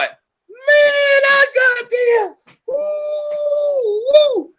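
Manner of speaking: screaming way up high, cry breaking into whoops; ecstatic howling. Real, not acted. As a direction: howl with wild glee, voice cracking high. Cheering Nicky D pulling gun.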